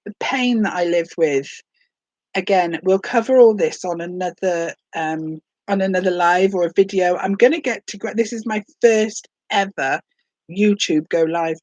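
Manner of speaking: normal talking voice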